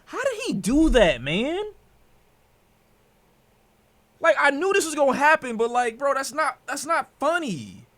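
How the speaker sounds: high, loud, fed-up ranting; exasperated yell. Real, not acted. Streamer yelling at a player who ruined his parlay, loud exasperation. Raging over a lost parlay.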